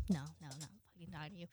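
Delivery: low, very soft muttered refusal; minimal replies. Retold or imitated. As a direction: mutter it under your breath, giving nothing. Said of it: "very minimally".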